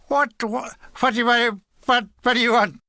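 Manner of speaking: put-on clipped, stammering old major; curt stammering major. A narrator's reading. Major cutting off an unwanted private, curt and stammering. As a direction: snap the repeated whats impatiently. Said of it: "he said curtly".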